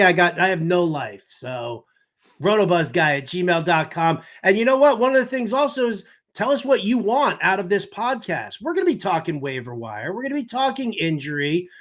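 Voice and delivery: normal talking voice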